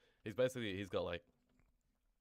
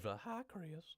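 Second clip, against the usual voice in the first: put-on old man voice; 10 semitones higher than usual. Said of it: "the old man from".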